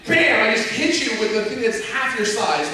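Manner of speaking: high, punchy mock attack cry; hammed-up triumphant player; excited battle boast. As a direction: burst out like a gleeful game hero. Acting player attacking Ganon.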